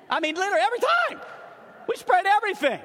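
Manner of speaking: high, shouted exasperation; loud, high-pitched exasperated outburst; comically bitter. Real, not acted. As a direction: yell the grievance in disbelief, for laughs. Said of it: "I'm bitter right now to this day".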